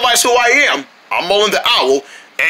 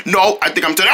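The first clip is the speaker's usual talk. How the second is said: ordinary delivery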